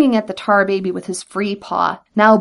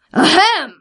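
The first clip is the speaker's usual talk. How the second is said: a pointed, raised throat-clear; loud ahem; 7 semitones higher, 4 dB louder than usual. A narrator's reading. Brer Rabbit at a silent tar baby, loud ahem. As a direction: clear the throat loudly to be noticed. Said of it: "said Brer Rabbit loudly".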